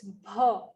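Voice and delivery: "bha" with a clear open a, not "bu"; correct bha. Shown as a demonstration. Teacher correcting chanting, "bha" with aspirated bh and open a. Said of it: "It's not "bu," it's".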